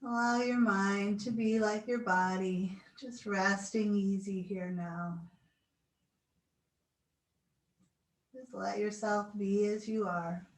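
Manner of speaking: slow, soothing guided relaxation. Acted. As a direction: talk them gently into stillness. Leads final relaxation.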